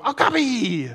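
put-on high little-kid squeal of delight; high, drawn-out childlike exclamation; excited young niece. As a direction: imitate a small niece proudly naming an animal. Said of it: "And she'd go".

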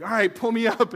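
loud, pained request to his wife; strained call. Retold or imitated. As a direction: call out while being hauled up. Asking wife to pull him up.

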